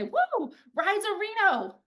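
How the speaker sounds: very high, thrilled whoop; whooping coach. Retold or imitated. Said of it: "I heard my coach say".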